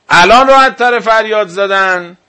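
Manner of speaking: rising loud rhetorical question, pressed hard; loud challenge. Real, not acted. Preacher challenging his audience, loud and pointed. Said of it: "repeats the question to press it".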